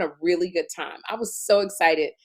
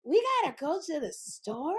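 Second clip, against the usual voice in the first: softer, higher, baffled question; dumb blonde; 7 semitones higher, 8 dB quieter than usual. Retold or imitated. Retelling her dumb blonde echo of Kim, soft high baffled question. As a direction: dim echo trailing up into a question. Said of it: "And I would go".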